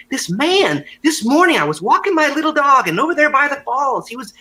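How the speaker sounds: put-on a fussy older lady working herself up; over-the-top older lady. Older lady tells the guys about the naked man, high and over the top. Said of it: "the lady was, like, just over the top".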